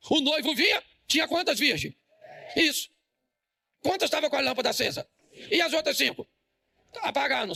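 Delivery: high, pushing sermon questions; rousing preaching. Acted. Pastor quizzing congregation on the parable, rousing preaching.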